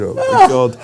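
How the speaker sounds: high, loud horrified gasp of words; partner's stunned oh my God; mock shock. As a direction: squeal it in mock horror.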